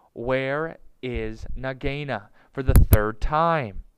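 slow, loud, word by word; impatient, spaced-out question; exasperated demand. A narrator's reading. Impatient mongoose repeats his question.

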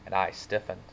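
ordinary delivery, nothing marked

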